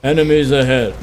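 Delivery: monotone voice. Retold or imitated. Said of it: "monotone voice".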